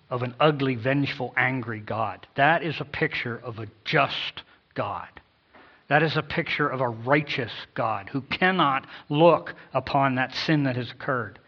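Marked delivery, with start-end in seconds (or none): none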